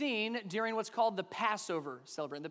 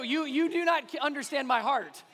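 loud plea; 6 semitones higher, 5 dB louder than usual. Real, not acted. Pleading with laughing congregation.

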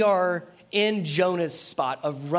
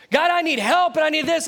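higher, loud, demanding plea; 11 semitones higher, 9 dB louder than usual. Acted. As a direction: demand help loudly. Person begging God.

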